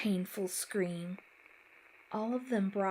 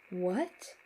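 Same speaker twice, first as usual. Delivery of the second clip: mildly puzzled, rising question; a touch of bewilderment; confused. A narrator's reading. Brother overhearing the phone call, confused. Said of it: "I heard a confused Ricky say".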